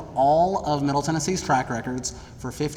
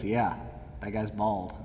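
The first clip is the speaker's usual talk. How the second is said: low, much softer deadpan reply; deadpan teacher; 6 semitones lower, 9 dB quieter than usual. Retold or imitated. Teacher calmly confirming the bald role, low deadpan. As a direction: drop it flat and quiet like a dry teacher. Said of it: "And he goes".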